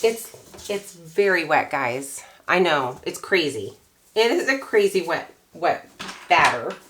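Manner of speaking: ordinary talk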